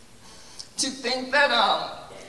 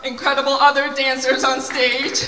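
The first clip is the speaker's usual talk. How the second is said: much louder and higher, projecting over the crowd; calling out; 4 semitones higher, 7 dB louder than usual. Real, not acted. Teacher thanking dancers over applause, loud and high. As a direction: call it out to the whole stage.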